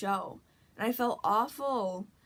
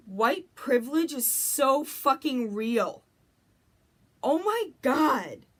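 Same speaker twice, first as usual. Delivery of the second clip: loud, slow, drawn-out outburst; raised voice, stretching each phrase in dismay; emphatic dismay; 4 semitones higher, 6 dB louder, slower than usual. Real, not acted. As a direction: exclaim it slowly, appalled. Upset outburst at own realization.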